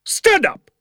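very high parade-ground command; sharp, high-pitched yell of command; shouted order. A narrator's reading. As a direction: bellow it at a schoolboy. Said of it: "He was shouting at me".

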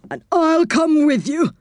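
high, put-on character voice; Saucepan man. A narrator's reading. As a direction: pipe up eagerly as the saucepan man. Saucepan man's character voice in story.

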